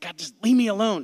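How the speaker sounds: angry outburst. Retold or imitated. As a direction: blurt it out in anger, loud and high. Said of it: "I was angry".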